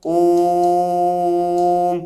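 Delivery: ओम held out long; chanted Om; prolonged Om. Shown as a demonstration. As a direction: stretch the ओम vowel. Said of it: "इस ओम शब्द में ... अवग्रह चिन्ह".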